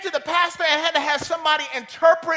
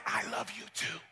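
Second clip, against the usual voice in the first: much softer and lower, small voice; soft child reply; 6 semitones lower, 12 dB quieter than usual. Retold or imitated. Boy answering the woman in a tiny hushed voice. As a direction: let it come out tiny and tender.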